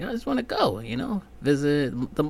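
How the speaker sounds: lightly dreamy wish to visit; wistful. Retold or imitated. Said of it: "I was like".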